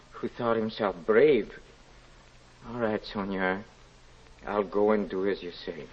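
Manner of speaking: soft, low, broken admission; broken, hushed surrender. Acted. Man yielding to Sonia, softly and humbly. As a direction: give in quietly and humbly.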